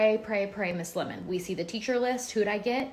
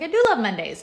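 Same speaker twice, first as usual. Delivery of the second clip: retold reply; 4 semitones higher, 8 dB louder than usual. Her own reply in the story.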